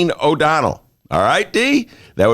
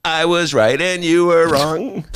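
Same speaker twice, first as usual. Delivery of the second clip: gloating; 5 semitones higher, slower than usual. Retold or imitated.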